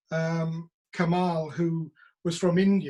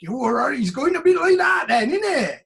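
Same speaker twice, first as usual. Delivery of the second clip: high, shouted village idiot caricature; 8 semitones higher, 8 dB louder than usual. Acted. Welsh actor mocking typecasting, loud village idiot voice. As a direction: holler it like the daft village idiot. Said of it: "they want the village idiot".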